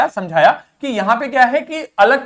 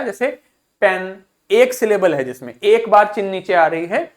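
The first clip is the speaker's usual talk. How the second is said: ordinary delivery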